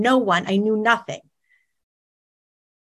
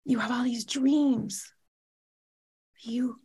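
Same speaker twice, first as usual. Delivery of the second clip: softer, leaning in, gently firm; quiet firm psychiatrist; 5 semitones higher, 6 dB quieter than usual. Retold or imitated. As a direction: quietly tell a patient the truth. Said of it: "She leans forward and says".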